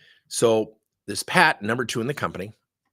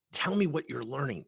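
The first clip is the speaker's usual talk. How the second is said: ordinary delivery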